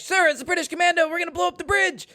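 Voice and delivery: high, strained mock yell; urgent squeal without full volume; frantic warning. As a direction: imitate a desperate soldier raising the alarm. Acting out frantic warning to colonel.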